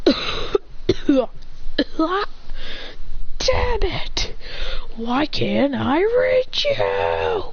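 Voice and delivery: slow, strained cry of frustration; exasperated battle cry, drawn out; frustrated outburst. Acted. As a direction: drag out each word, straining to reach. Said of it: "As he goes".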